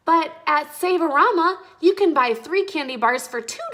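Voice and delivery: high, loud eager objection; child character bursting in; excited interjection. A narrator's reading. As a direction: jump in with a better idea. Mia jumps up with idea.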